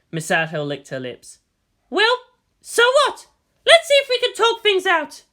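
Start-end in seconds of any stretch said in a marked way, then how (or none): 1.9-5.2 much higher, louder woman's voice; female commander voice. Acted. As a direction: brush it off, upbeat and bold. Misato voiced on the bridge.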